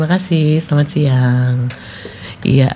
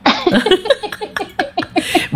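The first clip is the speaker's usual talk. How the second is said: high-pitched burst of laughter. Real, not acted. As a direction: let out a squealing laugh.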